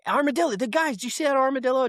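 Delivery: giddy dad voice, way up high; excited dad. Retold or imitated. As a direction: shout it out like a dad spotting an armadillo from the wheel. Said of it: "you know, being a dad".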